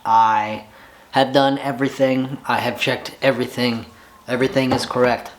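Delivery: usual speaking voice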